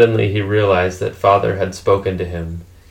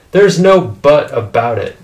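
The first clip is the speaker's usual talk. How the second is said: higher, louder indignant retort; firm rebuttal; 5 semitones higher, 6 dB louder than usual. A narrator's reading. Mother cutting off father's "but", indignant. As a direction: shut the objection down hard.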